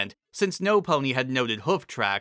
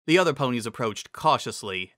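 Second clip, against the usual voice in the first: ordinary delivery, nothing marked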